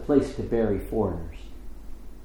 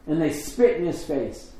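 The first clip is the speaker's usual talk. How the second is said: ordinary delivery, nothing marked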